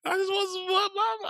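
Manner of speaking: high, whiny mock teenage wail, imitating a sulking boy. Cousin retelling the boy's phone tantrum, high whiny mock wail. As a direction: cry out like a sobbing teen, hammed up. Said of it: "screaming on the phone".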